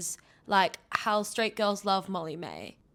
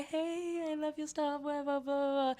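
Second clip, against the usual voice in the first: fangirl; 6 semitones higher, 6 dB quieter, slower than usual. Retold or imitated. Meeting the influencer, fangirling.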